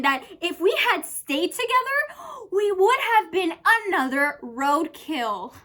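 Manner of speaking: high, loud, emphatic outpouring; worked-up ex-lover. Acted. Character likens a failed relationship to roadkill, loud and worked up. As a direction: push every phrase high and loud.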